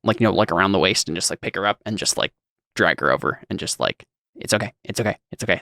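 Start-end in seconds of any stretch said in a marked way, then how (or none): 4.3-5.6 soothing reassurance. Acted. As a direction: quietly comfort someone shaken. Acted soothing of shaken Liz.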